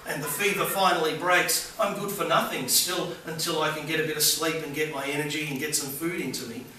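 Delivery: ordinary talk, nothing marked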